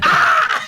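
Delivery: very high, loud shriek; a son seeing his dad with a chainsaw, shrieking; imitated scream. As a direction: scream like a terrified cartoon kid. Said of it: "He just screams".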